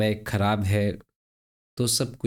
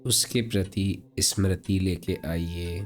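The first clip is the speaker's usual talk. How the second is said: guided meditation; 3 semitones higher than usual. Acted. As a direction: guide them unhurriedly through breathing, drawing words out.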